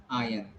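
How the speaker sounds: iron as EYE-un, silent R; teacher modelling iron without the R; correct pronunciation. Shown as a demonstration. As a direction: say iron as "ayan". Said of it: "You should call it".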